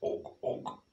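soft unvoiced caveman grunt; hushed og og; whispered grunt. Shown as a demonstration. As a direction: breathe the grunt for the kids. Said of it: "Can you all do that?".

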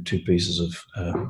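usual speaking voice

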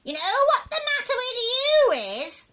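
high, loud, drawn-up accusing voice; high-pitched needling granny; cantankerous old grandma. Acted. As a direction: scold like a wicked old woman.

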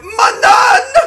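high, loud shouted British "My nun!"; British accent imitation. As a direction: yell it in a hammed-up British accent. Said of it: "it's also his accent".

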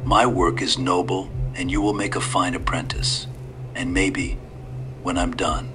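put-on a low, soft old gentleman; old man voice. Old stranger to the frightened boy, sly and hushed.

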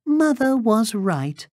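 put-on very high, earnest little-girl voice. A narrator's reading. Girl vows after her rescue, high childlike voice. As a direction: vow it in a piping child's voice.

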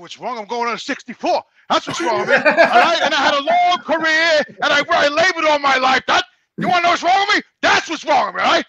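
getting fired up defending his worn-out body; indignant retort. Retold or imitated. Answering his mocking gym friend, a loud indignant rant.

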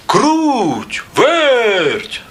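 high, drawn-out call with falling tail; rooster calling out. A narrator's reading. Rooster summons the mice to his find, calling out. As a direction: call the names out long and high. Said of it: "став гукати півник".

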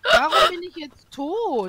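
loud, slow, high disbelief; indignant surprise. Real, not acted. Just killed, protesting.

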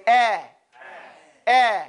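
"Eh" with the mouth open wide. Shown as a demonstration. Teacher leading the class in an open "Eh". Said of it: "Everybody say".

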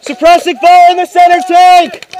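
high loud command yell; cover me shout. Real, not acted. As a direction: shout it across the field. Ordering cover fire.

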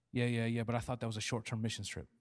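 quiet, quick yeah-yeah dodge; dismissive brush-off. Retold or imitated. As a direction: wave it off quickly. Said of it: "believer dodging".

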